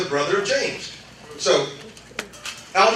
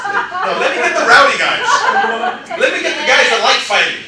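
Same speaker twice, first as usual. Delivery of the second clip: excited, louder and higher, gleeful; rowdy call; 7 semitones higher, 5 dB louder than usual. Pretend. Preacher voicing Jesus choosing the rowdy guys, excited. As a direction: pick out the fighters with a grin.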